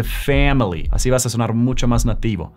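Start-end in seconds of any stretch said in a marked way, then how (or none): 0.0-0.9 family as "FAM-lee", wide æ; American A in family. Shown as a demonstration. Coach corrects to native sound, "family" with wide æ. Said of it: "se dice family".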